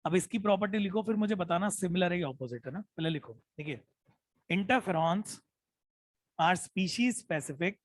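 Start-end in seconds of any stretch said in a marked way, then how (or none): 4.5-7.8 slow dictation. Shown as a demonstration. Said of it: "मैं जानबूझ के धीरे बोल रहा हूँ".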